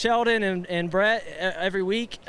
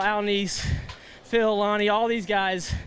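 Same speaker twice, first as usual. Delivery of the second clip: usual speaking voice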